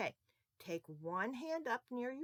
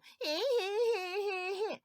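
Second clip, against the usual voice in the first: high, jeering imitation cry; mock mean laugh; 13 semitones higher, 5 dB louder than usual. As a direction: imitate a kid mocking a crying sister. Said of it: "and you go".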